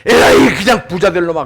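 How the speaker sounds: mock angry shout, loud; exploding in anger. Pastor turns a curse into blessing, mock angry shout. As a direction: burst out as if cursing, playfully. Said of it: "막 속 확 올라오는 거예요".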